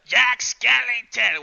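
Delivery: high, loud, slow theatrical voice; Jack Skellington voice. Retold or imitated. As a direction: announce the name grandly like a showman. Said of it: "he did the voice for Jack Skellington".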